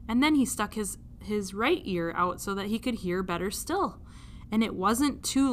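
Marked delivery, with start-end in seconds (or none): none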